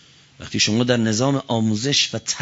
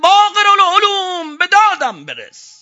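shouted very high and very loud cry; desperate cry for help; 9 dB louder than usual. Acted. Preacher voicing the truth crying for rescue, full shout. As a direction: cry out to Baqir al-Ulum like the trapped truth begging rescue. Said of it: "حق فریاد زد".

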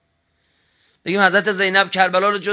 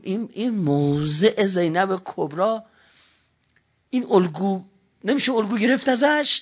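normal talking voice

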